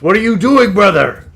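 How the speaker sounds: high, loud bewildered warrior; confused brute. Acted. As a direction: shout it in confused alarm. GM voices charmed Dragonborn.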